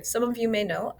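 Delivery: ordinary talk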